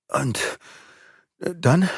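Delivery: faltering question with long gaps; hesitant, intimidated. A narrator's reading. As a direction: ask timidly, searching for words. Frightened youth asks what follows.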